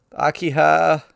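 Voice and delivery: high, loud call of her name. Acted. Boy calling out to Akiha over the body, loud alarmed cry.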